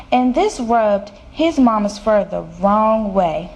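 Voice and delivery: ordinary delivery, nothing marked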